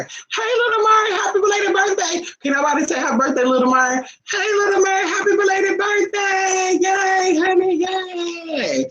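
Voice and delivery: high, slow, stretched-out shout of joy; excited birthday shout. Real, not acted. To Little Mer, a high stretched birthday holler. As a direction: holler happy birthday across the room.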